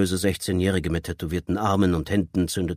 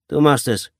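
loud, raised-pitch order; forceful ringleader's command; 8 semitones higher, 8 dB louder than usual. A narrator's reading. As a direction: order the youngest to obey. Leader orders the youngest boy.

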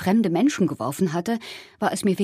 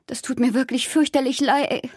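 higher-pitched, fretful apology breaking off; attempted apology, cut off; 6 semitones higher than usual. A narrator's reading. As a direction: start apologizing anxiously, stop mid-word. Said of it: "versuchte ich zu sagen". (klägliche, abgebrochene Entschuldigung)

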